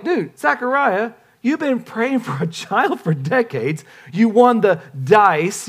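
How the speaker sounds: slow, high-pitched mock disbelief; exasperated Gabriel. Angel Gabriel answering doubting Zechariah, comically exasperated. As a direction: spell it out for him, incredulous. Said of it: "Gabriel's going like".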